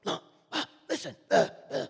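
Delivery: high, loud barked section commands; screaming orders. Retold or imitated. Lead trumpeter yells phrasing at the young player, high loud barking. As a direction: bark it like a drill sergeant. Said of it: "[name] was just screaming at me".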